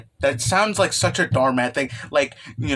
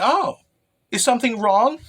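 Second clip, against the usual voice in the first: put-on high sweet girl voice; 7 semitones higher than usual. Skusa-chan voiced, concerned.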